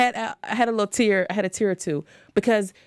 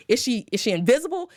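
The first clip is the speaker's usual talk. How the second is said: normal talking voice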